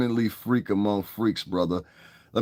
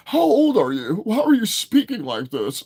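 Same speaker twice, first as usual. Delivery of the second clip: mocking commenter; 12 semitones higher, 4 dB louder than usual. Mocking commenters who question him.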